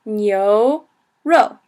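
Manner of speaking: "nyoro" slowly, rising nyo, falling ro; second and fourth tone. Shown as a demonstration. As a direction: stretch "nyoro" into two separated syllables. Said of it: "second tone, fourth tone".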